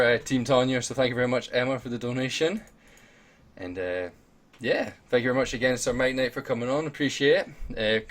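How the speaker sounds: usual speaking voice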